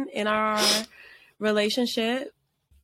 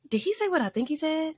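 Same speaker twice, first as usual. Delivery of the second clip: higher-pitched retold bewilderment; freaked-out disbelief; 5 semitones higher than usual. Calling best friend after the confession, freaked out. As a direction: replay your baffled questions to a friend. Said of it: "I was freaking out".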